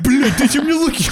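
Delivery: high, loud laughing demand; mock tantrum. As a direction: stamp your foot and demand it, half laughing.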